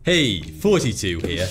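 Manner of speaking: ordinary talk, nothing marked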